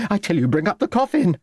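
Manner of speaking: high, quick, insistent order. A narrator's reading. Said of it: "raising her voice".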